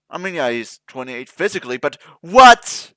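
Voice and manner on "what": much louder, higher, rising disbelief; incredulous what. Real, not acted. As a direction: cry out in utter confusion. Disbelief at physical age.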